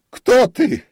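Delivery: high, loud panicked yell; sharp unnatural shout. A narrator's reading. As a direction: scream the challenge in terror. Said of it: "крикнул он резким, не своим голосом".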